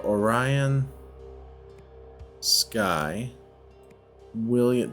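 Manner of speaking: normal talking voice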